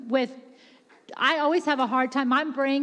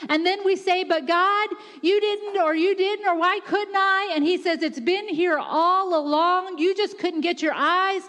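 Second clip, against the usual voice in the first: insistent preacher, piling up the phrases; building impassioned preaching; 4 semitones higher than usual. Real, not acted. Preacher to congregation about toiling for God, building fervently. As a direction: build steadily to a high, driving climax.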